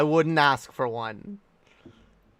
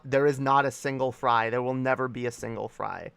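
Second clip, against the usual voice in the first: ordinary talk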